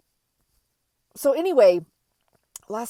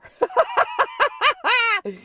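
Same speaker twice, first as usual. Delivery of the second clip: bright, loud laugh that falls away; high burst of laughter; 8 dB louder than usual. Real, not acted. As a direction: laugh out high and loud, then let it drop. Laughs at partner's remark.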